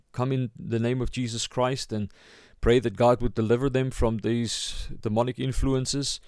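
normal talking voice

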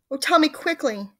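high, loud, pleading; urgent cry. A narrator's reading. Missus Twit begging for the cure, crying out urgently. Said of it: "she cried".

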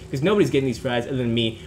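normal talking voice

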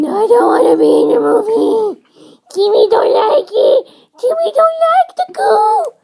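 put-on loud, high-pitched child hamster protest; tantrum shouting. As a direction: scream your refusal like a small child. Child hamster protests being taken.

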